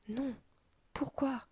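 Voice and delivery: put-on a boy's anguished why; anguished cry. Hap falls to his knees after the killing, anguished cry. Hap sees the prisoners killed.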